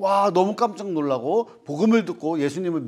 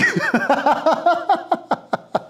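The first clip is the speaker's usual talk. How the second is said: high, rapid burst of laughter; real laughing fit; 10 semitones higher than usual. Real, not acted. Teacher laughing at their own acrostic attempt, high real laugh. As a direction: crack up in a long high laugh.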